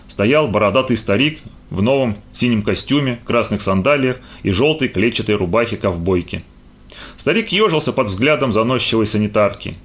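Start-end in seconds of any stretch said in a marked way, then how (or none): none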